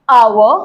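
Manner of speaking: आवर starting straight on the vowel; आवर without the H; silent H. Shown as a demonstration. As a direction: say आवर with no H sound. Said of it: "ओ से".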